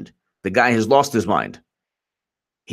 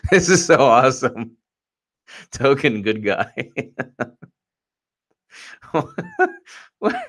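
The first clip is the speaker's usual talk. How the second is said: ordinary talk, nothing marked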